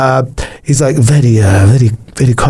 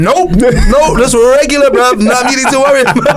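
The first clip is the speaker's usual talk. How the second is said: high, fast, laughing cover-up; laughing denial; 9 semitones higher than usual. Pretend. Laughing to a nosy mate that nothing happened. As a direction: brush it off while cracking up. Acted evasive reply, laughing.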